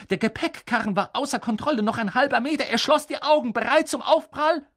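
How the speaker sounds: building from normal to high and loud, rushing toward the crash; mounting tension. A narrator's reading. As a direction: let the tension climb line by line to a peak.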